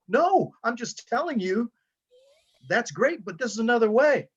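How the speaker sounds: high, quick reassurance; reassuring. Retold or imitated. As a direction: soothe her fast and lightly, voice lifted. Coach reassures girl in retold story.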